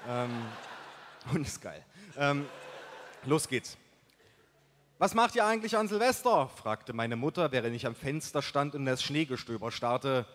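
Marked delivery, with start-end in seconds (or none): 5.0-6.5 higher-pitched mother's question; voiced mother. A narrator's reading. Mother asking her son about New Year's, higher mother voice.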